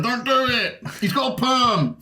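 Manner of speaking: high mock drunk heckling; heckler shout. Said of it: "drunk hecklers going".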